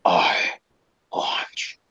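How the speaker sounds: a close ghostly whisper; creepy whisper. Retold or imitated. Voice right next to the boy, whispered. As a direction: breathe it right beside his ear. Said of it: "the voice is right next to me".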